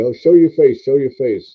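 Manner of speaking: mock urging to show his face; coaxing call. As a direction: call out like a cheerful nudge. Urging himself on camera.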